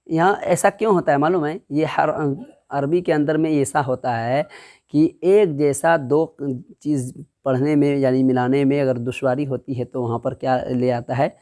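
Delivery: usual speaking voice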